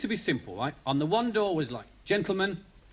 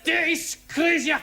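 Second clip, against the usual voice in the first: furious Scotsman; 12 semitones higher, slower than usual. Retold or imitated. Scottish prisoner shouts at the guards, high and slow. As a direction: rage at the guards like a caged Scot. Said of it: "he's like".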